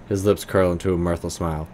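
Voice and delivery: normal talking voice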